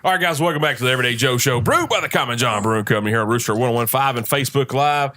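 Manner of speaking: brisk show announcer read; radio host intro. Acted.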